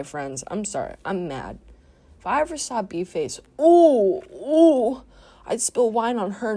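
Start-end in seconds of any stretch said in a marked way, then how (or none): 3.6-6.6 high, loud gleeful ooh; gleeful anticipation. Real, not acted. Imagining meeting B-Face, high gleeful ooh. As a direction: relish the thought of payback.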